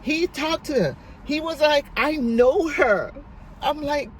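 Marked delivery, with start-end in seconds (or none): 2.0-3.1 high, louder and drawn out; emphatic retold recognition. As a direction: act out amazed recognition, stretching the words.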